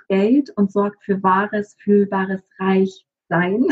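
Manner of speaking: slow, each word weighed, with Reichsein drawn out and Sein leaned on; stress on Sein. Shown as a demonstration. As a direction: linger on Reichsein and press the Sein. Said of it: "die Betonung liegt wirklich auf dem Sein". (langsam, Betonung auf dem Sein von Reichsein)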